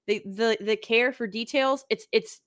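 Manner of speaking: ordinary talk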